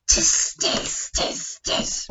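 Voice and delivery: soft voiceless monkey tsk; whispered monkey chatter. Acted. Monkeys mocking the peddler from the tree in a whisper. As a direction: hiss it like a cheeky monkey.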